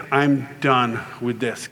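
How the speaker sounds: low, slow resolve; low-pitched firm refusal; finished with it. Retold or imitated. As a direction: say it slowly, fed up. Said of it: "I was just like".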